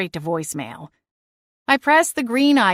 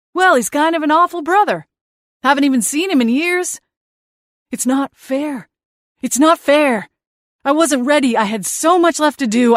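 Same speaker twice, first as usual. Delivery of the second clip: high, petulant whining dead brother; whiny ghost brother; 5 semitones higher, 3 dB louder than usual. Acted. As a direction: sulk like a wronged little brother. Seth whining.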